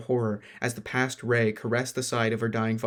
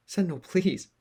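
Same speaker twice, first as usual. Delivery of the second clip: pleading; 7 semitones higher than usual. Acted. Pleading friend.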